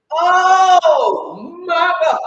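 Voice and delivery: sobbing shout, very slow and loud; crying out. Real, not acted. Overwhelmed vlogger crying out to viewers, sobbing shout. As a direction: let it break out of you in a loud sob.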